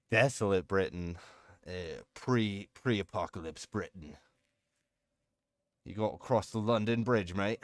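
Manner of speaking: half-committed mock British accent; joking put-on British accent. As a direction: play at sounding British, briefly. Joking put-on British voice, then gives up.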